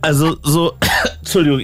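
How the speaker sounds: choking on a drink. Real, not acted. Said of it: "ich hab mich gerade verschluckt".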